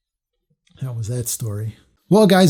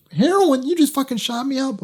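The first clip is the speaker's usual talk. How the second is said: shocked disbelief; 11 semitones higher than usual. Retold or imitated. Shocked at being shot up.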